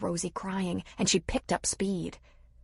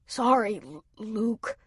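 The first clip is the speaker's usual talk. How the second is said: shivering, teeth chattering on the words; 3 semitones higher, slower than usual. Acted. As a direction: stammer through chattering teeth. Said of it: "teeth chattering".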